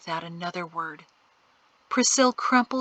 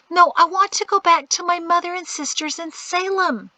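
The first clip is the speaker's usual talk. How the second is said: put-on pleading girl; 10 semitones higher, 4 dB louder than usual. A narrator's reading. Homesick girl voiced high.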